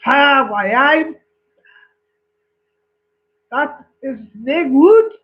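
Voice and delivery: put-on dismissive Scotsman with a Scottish accent. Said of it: "The Scottish man says".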